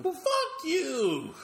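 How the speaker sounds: high, slow, drawn-out fuck-you; defiant retort. Acted. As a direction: stretch it out high and slow to mock him. Retort to the cop on screen.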